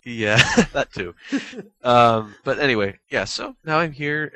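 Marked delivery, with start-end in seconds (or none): 0.0-1.1 laughing. Real, not acted. Laughing at cohost's joke.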